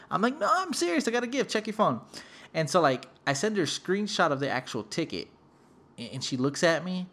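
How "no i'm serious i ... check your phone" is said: high-pitched earnest insistence; insistent protest. Retold or imitated. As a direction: insist you mean it, voice up high. Insisting to disbelieving sister in story.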